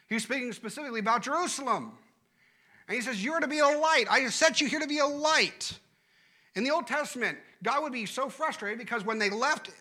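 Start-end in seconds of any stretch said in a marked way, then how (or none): 3.2-5.8 high, loud exasperated divine rebuke; a preacher voicing an angry, fed-up God. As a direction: let God's frustration burst out high and loud. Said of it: "he gets so angry about it".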